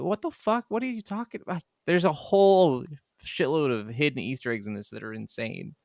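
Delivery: ordinary delivery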